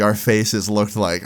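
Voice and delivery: usual speaking voice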